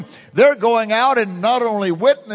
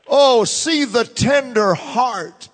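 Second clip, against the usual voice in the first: normal talking voice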